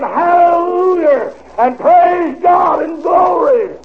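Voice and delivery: high, loud jubilant shouts; hollering praise. Retold or imitated. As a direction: shout it out like the saints leaping on the corner. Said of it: "and hollered".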